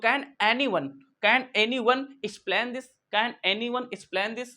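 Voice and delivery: very slow, drawn-out question; slow classroom drill; slow model sentence. Shown as a demonstration. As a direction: stretch each word for the class. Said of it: "class को समझा सकता है".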